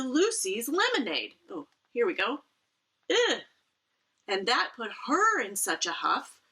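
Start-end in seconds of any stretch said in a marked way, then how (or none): 3.1-3.4 high, loud groan of disgust; loud disgusted ugh. Real, not acted. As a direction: recoil at the mess. Reaction to messy food.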